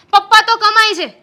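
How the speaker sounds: mock his excuse with rising anger; angry mockery. Wife mocks husband's excuse, building. (પપ્પા પર ભાર, ઊંચા અને મોટા અવાજે ટોણો)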